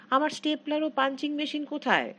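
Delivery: shout. A narrator's reading. Husband shouting for his things.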